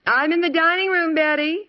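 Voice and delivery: mother calling to her daughter down the hall. Acted. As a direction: call out so Betty hears you.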